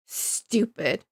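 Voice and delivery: low, soft, seething aside; quietly mad. Real, not acted. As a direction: say it under the voice, annoyed. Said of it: "I'm so mad!".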